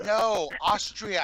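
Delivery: slow correcting. Retold or imitated. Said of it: "It's like".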